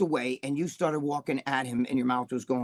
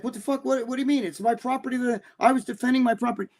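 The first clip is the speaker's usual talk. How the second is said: higher-pitched, indignant outburst of disbelief; indignant protest; 7 semitones higher, faster than usual. Retold or imitated. Bob protesting the judge's ruling, higher and indignant. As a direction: protest like a wronged gym owner. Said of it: "And Bob's like".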